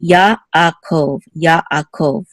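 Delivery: Yaakov as ya, pause, a-kov, said twice; separated sounds. Shown as a demonstration. Teacher separating the sounds here, Yaakov with a gap after ya. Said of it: "we're gonna separate the sounds also here".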